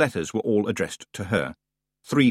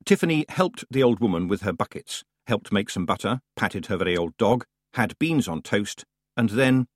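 ordinary delivery

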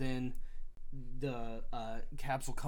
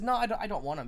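loud, higher, hurried refusal; retold refusal; 7 semitones higher, 5 dB louder, faster than usual. Kaladin refusing the Shardbearer's shards, loud and higher. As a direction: push the offer away, loud and fast. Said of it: "And he said".

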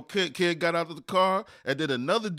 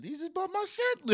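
much higher, incredulous squeal; outraged disbelief; 5 dB quieter than usual. Retold or imitated. To the driver who bumped his car, high and incredulous. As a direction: let the pitch jump right up in outrage.

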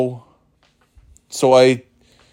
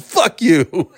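high, drawn-out defiant last curse; hammed-up final words, pitched way up; mock dying breath; 10 semitones higher, 3 dB louder than usual. Gunned-down player cursing his killer with his dying breath, mock defiant. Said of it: "his dying breath is".

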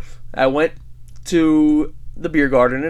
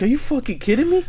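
higher-pitched, indignant disbelief; incredulous protest; 10 semitones higher than usual. Retold or imitated. Man protesting to cops who want him upstairs, incredulous. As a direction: retell it as an affronted, rising question.

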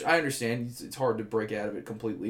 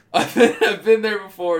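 laughing; 8 semitones higher, 10 dB louder than usual. Real, not acted. Laughing while reading post.

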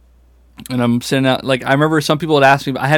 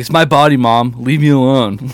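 put-on defiant teen; 5 dB louder than usual. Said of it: "I'm like".

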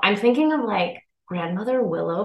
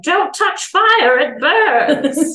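put-on high, slow, cackling old-woman advice; wacky old lady voice; 8 semitones higher, 6 dB louder, much slower than usual. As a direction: play a kooky wise granny warning a child.

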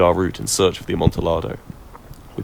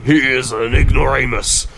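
put-on drunken interruption; 4 semitones higher, 5 dB louder than usual. A narrator's reading. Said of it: "interrupted my friend".

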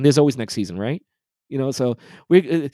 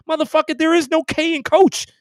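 incredulous outburst; 13 semitones higher than usual. Real, not acted. Fan ranting at Nike's coach slogan, high incredulous yell.

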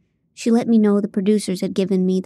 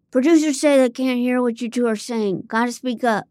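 sound guy; 4 semitones higher than usual. A narrator's reading. Sound guy voiced with cigarette.

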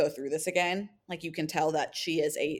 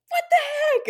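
much higher, louder delighted squeal; girl thrilled by a fantasy suite invite; squealed surprise; 8 dB louder than usual. Retold or imitated. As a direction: shriek it in happy shock. Excited reaction to proposal.

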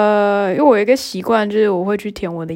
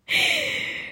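high breathy laugh; 7 dB quieter than usual. Real, not acted. Burst of laughter recalling mishap.

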